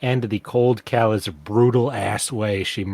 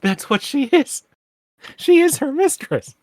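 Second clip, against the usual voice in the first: exasperated outburst; 9 semitones higher than usual. Real, not acted. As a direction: pitch it up in disbelief. Heated objection to friend's claim.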